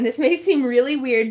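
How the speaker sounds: normal talking voice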